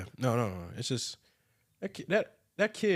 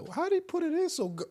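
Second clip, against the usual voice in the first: high, quick puzzled question; puzzled wonder; faster than usual. Retold or imitated. Woman wonders how the blind man managed it, high quick puzzlement. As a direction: wonder aloud, baffled and impressed. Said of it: "he just feeling like".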